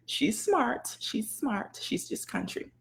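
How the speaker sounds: very soft, confiding mock verdict; hushed aside. Readers quietly deciding about the educated blogger, hushed aside. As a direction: drop right down as if gossiping.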